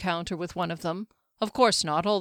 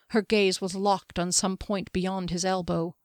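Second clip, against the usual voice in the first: usual speaking voice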